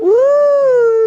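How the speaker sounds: high, loud woo; joyful whoop. Real, not acted. Wife thrilled by the wine, whooping. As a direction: cheer out loud. Gift reveal.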